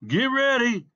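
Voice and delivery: high, loud starter's call. A narrator's reading. Starter calling racers to the line, shouted call. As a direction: call it out over a street crowd.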